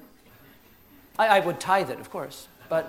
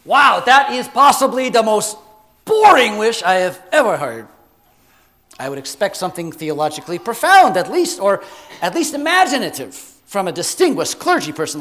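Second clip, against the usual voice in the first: loud, emphatic genie unimpressed by a wish; brash genie; 5 semitones higher, 13 dB louder than usual. Acted. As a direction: play a scornful genie granting it with loud bursts. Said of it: "And he goes".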